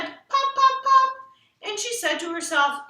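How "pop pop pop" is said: high, loud sing-song pops; much higher playful pops; practising cereal pops. A narrator's reading. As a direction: chirp them like the ad girl. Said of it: "tipped her head and said".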